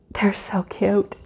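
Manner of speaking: soft breathy whisper. Real, not acted. Whispering to viewers about the cute animals.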